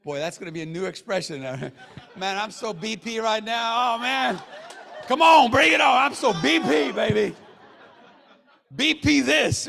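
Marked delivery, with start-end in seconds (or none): 2.2-7.3 hammed-up, loud, high bravado; mock hype shout. Hammed-up new catchphrase, laughing crowd. 8.7-9.7 loud, high playful taunt; mock bravado.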